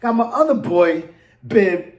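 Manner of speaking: ordinary talk, nothing marked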